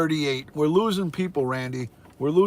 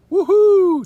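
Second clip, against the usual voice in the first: high, loud celebratory whoop; cheering; 11 dB louder than usual. Real, not acted. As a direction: whoop with joy. Cheering viewer count milestone.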